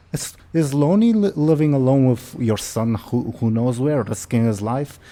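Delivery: guilt-trip your absent son loudly; scolding mother character, swinging high; complaining mother. Acted. Mother berates her absent son.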